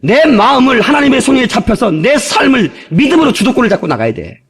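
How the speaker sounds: ordinary talk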